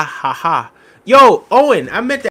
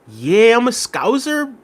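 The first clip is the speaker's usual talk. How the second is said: slow Scouse impression leaping very high at the end; put-on Scouse accent; 10 semitones higher, 4 dB louder than usual. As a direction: read it as an exaggerated Scouser, pitched up. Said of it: "he says".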